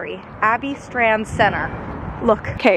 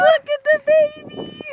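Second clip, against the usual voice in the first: excited shout. Real, not acted. Vlogger spots a baby on the path, delighted high shout.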